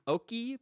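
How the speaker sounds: Oki said as English "oakey", high and questioning; puzzled high Oki; mock misreading. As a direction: mimic a reader guessing Oki the English way. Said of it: "Because you'd think, like".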